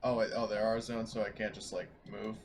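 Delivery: normal talking voice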